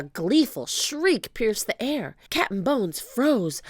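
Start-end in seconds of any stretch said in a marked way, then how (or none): none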